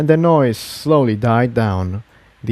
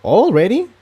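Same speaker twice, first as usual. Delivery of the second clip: higher, astonished question; exclaiming; 10 semitones higher than usual. Acted. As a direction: pitch it up in disbelief. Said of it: "Incendia exclaimed".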